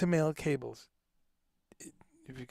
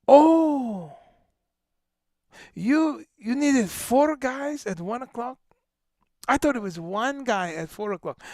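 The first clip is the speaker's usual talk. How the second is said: put-on Italian accent; 6 semitones higher, 5 dB louder than usual. Italian crew boss explaining one helper to a musician, hammed-up Italian accent. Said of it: "I do all kinds of accents".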